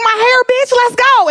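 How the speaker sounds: high, excited sassy brag; hyped client. Retold or imitated. Braided client to her friend, high sassy brag. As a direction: show off the new braids, sassy. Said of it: "She be like".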